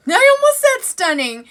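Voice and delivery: high, loud outburst; excited. Real, not acted. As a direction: squeal it, catching yourself. Laughing self-catch.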